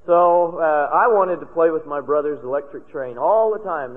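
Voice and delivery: normal talking voice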